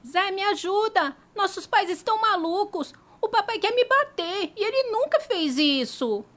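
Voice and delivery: put-on scared child. Scared child runs for help.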